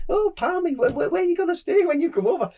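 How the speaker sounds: high-pitched imitation of a mother-in-law. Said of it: "my mother-in-law now, she said".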